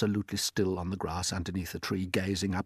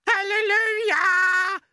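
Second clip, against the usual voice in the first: a far higher, louder aunt's shout; Aunt Spiker shouting; 9 dB louder, much slower than usual. Acted. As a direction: screech it out as the nasty aunt. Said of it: "Aunt Spiker shouted".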